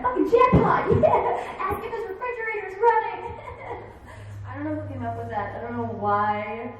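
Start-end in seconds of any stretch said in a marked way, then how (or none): 0.0-3.3 loud, high, laughing cheer; triumphant shout. Retold or imitated. Kid pranking a classmate, shouting and laughing. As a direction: whoop at the jackpot. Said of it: "You're like".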